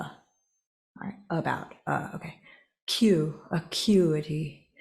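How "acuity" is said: slow acuity, the third syllable like itty, uh-KYOO-itty; acuity said carefully. Shown as a demonstration. Reader checking a word she can't pronounce, slow acuity. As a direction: spell out acuity, one syllable at a time, ending in itty. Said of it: "The third syllable is pronounced like itty".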